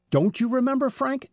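suddenly recalling a clue; excited exclamation. A narrator's reading. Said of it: "exclaimed Joe".

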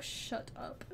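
breathy whispered shut up. Real, not acted. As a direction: hiss it under your breath. Teasing reply to chat.

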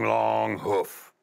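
ordinary delivery

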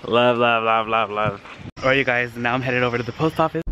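usual speaking voice

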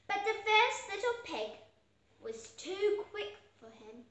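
ordinary delivery, nothing marked

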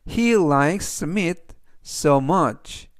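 each word spoken slowly and clearly for learners; slow model reading. Shown as a demonstration. Teacher reading the example sentence to learners, slow and clear. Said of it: "그래서 전체적 의미는".

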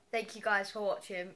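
ordinary talk, nothing marked

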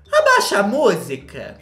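shouting order. Retold or imitated. Kika yelling on the phone for the music to go down, loud shout. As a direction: bellow it across the room.

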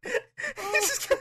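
ordinary talk, nothing marked

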